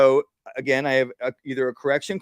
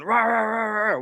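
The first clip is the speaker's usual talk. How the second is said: mock dog snarl; 6 semitones higher than usual. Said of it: "'cause it's going".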